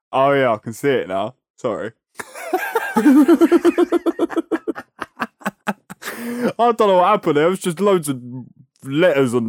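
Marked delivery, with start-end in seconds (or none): 2.2-6.5 long high laughing fit. Real, not acted. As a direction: crack up for a while. Laughs at own confusion.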